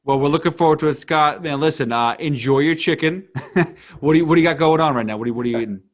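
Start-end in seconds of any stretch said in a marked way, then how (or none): none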